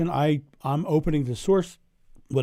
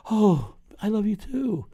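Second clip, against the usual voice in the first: drawn-out, higher "oh" of being deeply touched; touched, moved reply; 8 semitones higher, 4 dB louder than usual. Retold or imitated. Acting out a mother receiving unconditional love, touched and drawn-out.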